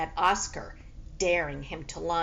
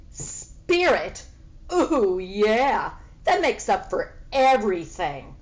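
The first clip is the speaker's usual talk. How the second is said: louder mocking "ooh, yeah"; sarcastic sneer; 5 dB louder than usual. Sneering cat mocks the pig's spirit, sarcastic. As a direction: drip sarcasm on every word.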